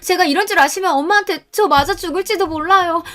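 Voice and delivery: put-on high, frantic begging young woman; desperate pleading. Daughter-in-law begging mother-in-law to keep secret, high frantic plea.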